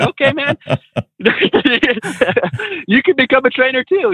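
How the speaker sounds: high-pitched, breaking into laughter; amused reply. Retold or imitated. Amused retelling of own reply.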